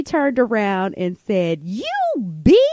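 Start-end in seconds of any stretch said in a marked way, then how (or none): 1.6-2.6 drawn-out mock angry outburst; retold shout. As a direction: imitate a friend yelling an insult. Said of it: "And shouted at her".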